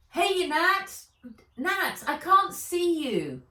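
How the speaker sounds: high, loud voice through a small speaker. A narrator's reading. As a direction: call out tinny from a device. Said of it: "said Wen through Fizz's speaker".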